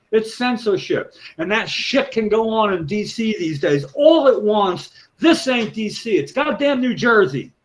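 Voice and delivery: high-pitched, impassioned tirade; really riled up. Real, not acted. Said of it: "I could tell you're riled up".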